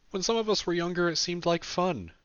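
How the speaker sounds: tired man's voice pitched high. A narrator's reading. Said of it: "his tired voice hitching a couple of times".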